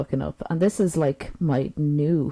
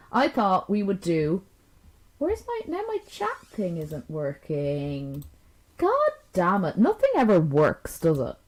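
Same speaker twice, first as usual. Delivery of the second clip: ordinary delivery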